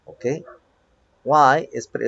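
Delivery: ordinary talk, nothing marked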